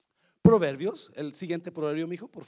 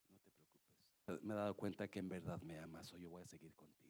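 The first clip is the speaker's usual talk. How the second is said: gentle forgiveness; 11 semitones lower, 14 dB quieter than usual. Acted. Wife forgives him gently.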